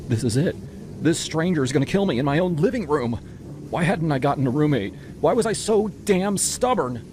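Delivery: terrified inner voice while being choked; panicked thoughts. A narrator's reading. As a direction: spiral into terror. Panicked thoughts during attack.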